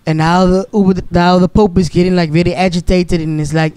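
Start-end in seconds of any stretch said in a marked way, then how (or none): none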